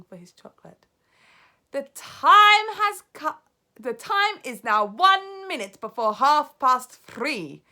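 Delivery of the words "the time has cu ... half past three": high, loud, slow witch proclamation; drawn-out villainous announcement; witch announcing. Acted. Grand High Witch announcing to the audience, slow and loud. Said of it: "announced the Grand High Witch".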